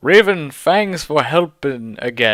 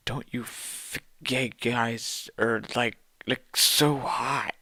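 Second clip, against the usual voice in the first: softer, half-whispered gush; hushed aside; 9 dB quieter than usual. Acted. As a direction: confide it under your breath. Whispered aside in author's note.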